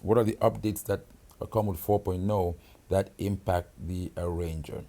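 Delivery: normal talking voice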